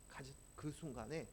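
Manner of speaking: normal talking voice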